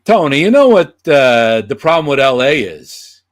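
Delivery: a brash lounge-singer host pitching a question; loud, showy puppet host; Johnny's talk-show voice. Retold or imitated. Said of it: "I go".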